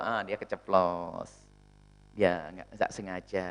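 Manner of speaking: low, slow, easygoing excuse; mild forgiving voice. Retold or imitated. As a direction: shrug it off gently, slowly. Imitating a forgiving, open-hearted person. (suara pemaaf yang santai dan pelan)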